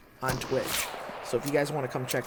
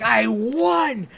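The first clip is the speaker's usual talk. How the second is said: excited shouting; 13 semitones higher, 5 dB louder, much slower than usual. Retold or imitated. Running around the house celebrating.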